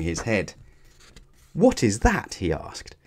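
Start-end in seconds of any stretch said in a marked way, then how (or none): 1.5-2.3 high-pitched, baffled query; stunned, rising disbelief; astonished question. A narrator's reading. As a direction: ask it in amazement. Stunned character asks after defeat.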